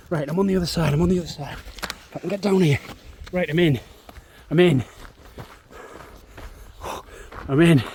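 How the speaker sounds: rising to loud triumphant calls of I'm in; excited relief. Real, not acted. Vlogger, just over the fence unseen, calls out in excited relief.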